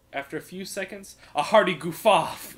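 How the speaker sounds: ordinary delivery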